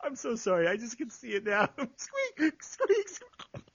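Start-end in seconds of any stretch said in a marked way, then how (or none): 1.8-3.6 high, giggly mouse imitation; tiny high squeaking; mouse squeaks. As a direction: squeak like a mouse through laughter.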